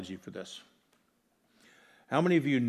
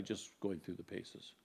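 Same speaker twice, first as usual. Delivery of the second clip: softer, dropped repeat of the phrase; quiet repeat; 3 semitones lower, 13 dB quieter than usual. Shown as a demonstration. Echoing his own admission to the church, softer. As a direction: let "going through the paces" sink in quietly.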